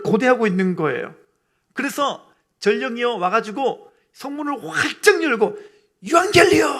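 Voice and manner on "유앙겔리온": high, loud ringing cry; long-held messenger's call; herald's shout. Acted. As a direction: burst through the gates shouting the news. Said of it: "이렇게 외칠 때는요".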